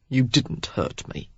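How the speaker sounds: soft, slow and cold; cold quiet. A narrator's reading. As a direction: say it icily. Derek cold flat.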